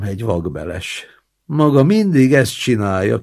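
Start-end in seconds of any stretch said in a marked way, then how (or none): 1.4-3.2 loud scolding roommate; shouted. Acted. Angry roommate shouts at the old man, loud. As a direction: call it out in disgust. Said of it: "kiáltotta".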